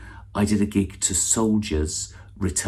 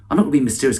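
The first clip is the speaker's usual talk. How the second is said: ordinary talk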